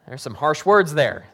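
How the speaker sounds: usual speaking voice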